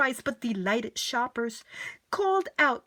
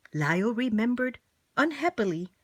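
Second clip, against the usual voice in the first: plain reading voice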